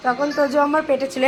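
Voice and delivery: ordinary talk, nothing marked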